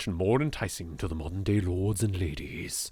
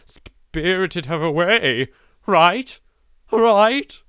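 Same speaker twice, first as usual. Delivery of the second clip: panicky; 9 semitones higher, 6 dB louder, much slower than usual. Acted. Spooking herself over Rose.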